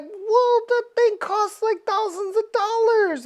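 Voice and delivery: Mister Fantastic. Acted. Mister Fantastic whining about cost in a high voice. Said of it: "Mister Fantastic's like".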